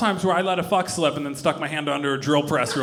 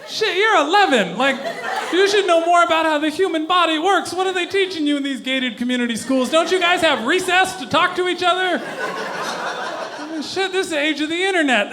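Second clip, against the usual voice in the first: exasperated inner voice; 10 semitones higher than usual. Retold or imitated. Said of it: "I thought".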